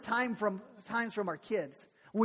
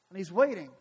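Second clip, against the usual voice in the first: ordinary talk, nothing marked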